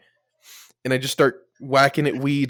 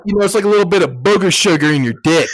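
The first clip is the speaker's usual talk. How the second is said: quick bro coach; 5 semitones higher, 7 dB louder, faster than usual. Retold or imitated. Coach voiced, quick and loud.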